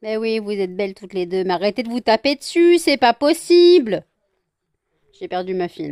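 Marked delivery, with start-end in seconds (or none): 1.4-4.0 exasperated scolding. Real, not acted. Owner to two fighting pets, rising exasperated scolding. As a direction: raise the voice in exasperation.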